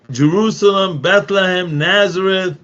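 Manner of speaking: normal talking voice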